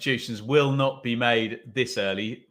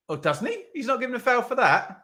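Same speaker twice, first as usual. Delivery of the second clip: incredulous laugh; 11 semitones higher, faster than usual. Real, not acted. Disbelief at referee's decision.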